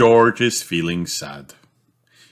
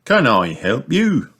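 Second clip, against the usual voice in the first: higher, cheery offer of help; beaming sing-song question; beaming shopkeeper; 7 semitones higher, 3 dB louder than usual. Acted. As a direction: swoop up brightly like an eager shopkeeper. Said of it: "beams Mister Fox".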